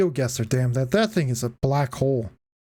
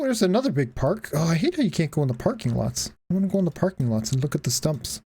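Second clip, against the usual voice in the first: ordinary delivery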